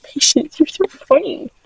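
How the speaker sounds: high, gentle coo; fond pet talk. Real, not acted. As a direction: coo softly to a funny animal. Cooing to her pet sheep.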